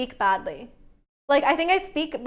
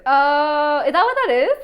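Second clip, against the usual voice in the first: surprised realization; 3 semitones higher, 7 dB louder than usual. Real, not acted. Learning the word Scousers.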